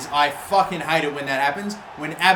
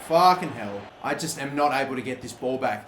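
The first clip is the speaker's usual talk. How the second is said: normal talking voice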